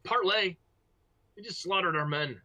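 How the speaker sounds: high, loud indignant question; incredulous enemy leader. Acted. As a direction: throw the word back in outrage. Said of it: "He said".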